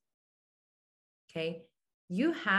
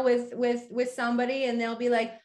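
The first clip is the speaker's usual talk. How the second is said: ordinary talk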